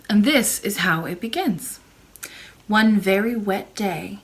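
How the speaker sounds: plain reading voice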